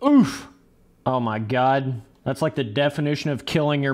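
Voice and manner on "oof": sharp high loud oof at a crash; pained oof. Real, not acted. Streamer reacting to a rider's crash with a sharp loud oof. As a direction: wince out loud.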